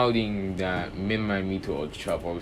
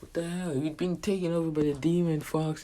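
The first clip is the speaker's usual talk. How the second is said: much higher, startled outcry; shocked disbelief; 9 semitones higher than usual. Retold or imitated. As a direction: react as if the fox just took over your friend. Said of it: "And they're like".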